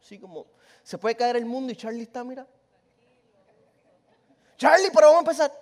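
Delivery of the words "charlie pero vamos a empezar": loud call across the room; raised voice hailing a calm friend. Retold or imitated. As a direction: call him to start, loud and high. Calling out to Charlie loudly.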